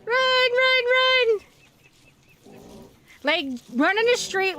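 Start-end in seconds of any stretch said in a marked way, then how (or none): 0.0-1.4 high, loud panicked run calls; terrified horror victim. Real, not acted. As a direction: scream at them to run. Fleeing monsters again.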